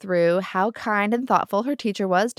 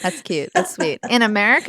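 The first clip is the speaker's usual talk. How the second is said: sarcastic scoff; 2 semitones higher, 5 dB louder than usual. Real, not acted. Co-host mocking hope that money leaves American politics, sarcastic scoff. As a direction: laugh it off with scorn.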